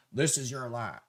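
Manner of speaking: a hint of George W. Bush; George W. impression. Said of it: "I said it like George W".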